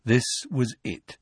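usual speaking voice

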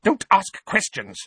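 high, sharp rebuke; snapped. Acted. Aunt silencing her nephew's questions, snapping. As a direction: shut the question down at once. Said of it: "Aunt Petunia snapped".

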